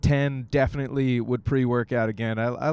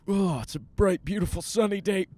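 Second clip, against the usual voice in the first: burping through it; 7 semitones higher than usual. Real, not acted. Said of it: "We're just burping through it".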